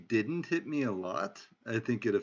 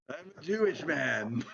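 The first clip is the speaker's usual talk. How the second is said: put-on Jersey accent; 4 semitones higher than usual. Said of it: "Wow, nailed it completely".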